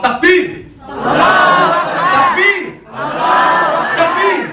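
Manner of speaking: loud rousing call to the crowd. Real, not acted. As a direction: shout it out to the congregation. Leader calls out takbir.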